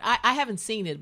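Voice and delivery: usual speaking voice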